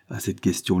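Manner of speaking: ordinary delivery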